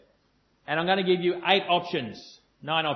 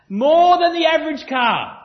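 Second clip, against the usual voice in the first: excited punchline; 7 semitones higher, 9 dB louder than usual. Real, not acted. Punchline of statistic.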